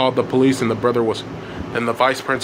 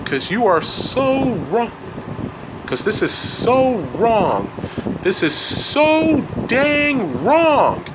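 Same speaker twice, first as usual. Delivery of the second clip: high, drawn-out indignation; much higher, fed-up protest; angry rant; 9 semitones higher, slower than usual. A narrator's reading. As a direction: stretch the key words in outrage. Elliot's angry rant goes on.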